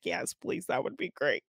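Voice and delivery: soft, understated reply in Conrad's voice; mild quiet Conrad quote. Retold or imitated. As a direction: drop to a hushed, lightly voiced Conrad. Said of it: "Conrad's like".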